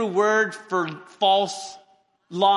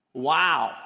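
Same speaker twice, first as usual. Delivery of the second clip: normal talking voice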